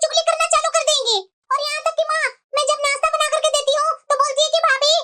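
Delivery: petulant daughter airing grievances; complaining daughter. Acted. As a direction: sulk and complain to mom. Daughter lists in-law grievances.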